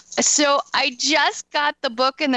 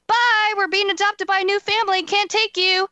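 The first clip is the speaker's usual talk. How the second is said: imitating the stranger in a much higher, sing-song voice; mocking singsong quote; 10 semitones higher than usual. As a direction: toss it off in a silly high voice, like a cheerful goodbye. Retelling a stranger's parting words.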